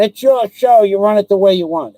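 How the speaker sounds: high, loud mock imitation of the commenters; mocking the guys. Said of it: "you had a couple of guys going".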